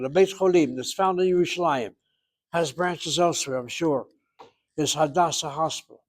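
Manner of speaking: ordinary talk, nothing marked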